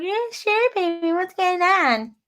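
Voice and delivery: high, sing-song sugary voice; sugary high "yeah sure baby"; mock sweet reply. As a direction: play it overly sweet and innocent. Her sugary reply to boyfriend.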